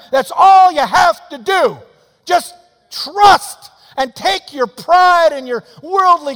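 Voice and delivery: loud, rising revival shout, pushing harder each line; full-force preaching. Acted. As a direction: preach it out to the back pew, building to a roar.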